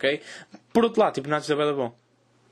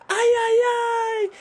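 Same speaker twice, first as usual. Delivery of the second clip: louder, very high squeal; mock scream; 4 dB louder, slower than usual. Man repeating the signal to his silent friend, hammed-up scream. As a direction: squeal the signal with comic insistence. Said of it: "Eu grito".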